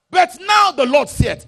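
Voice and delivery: high, loud, slow proclamation; shout. Acted. Preacher shouting the Lord's words at the church. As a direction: bellow it out as the turn.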